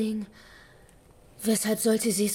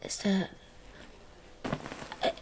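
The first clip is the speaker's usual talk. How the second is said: frightened whisper; 7 dB quieter than usual. Acted. Officer asking the killer if Waldheim died, fearful whisper. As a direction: barely dare to ask it.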